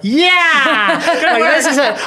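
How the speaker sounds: high, loud, gleeful cheer; hammed-up elated whooping; Worf. The Klingon thrilled while everyone is shocked, gleeful. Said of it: "shocked except for Worf, the Klingon".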